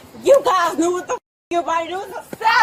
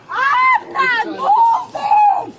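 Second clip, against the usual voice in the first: slow, high strained yelling; high distressed shout; much slower than usual. Real, not acted. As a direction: cry it out while pinned down. Resisting, pinned by officers.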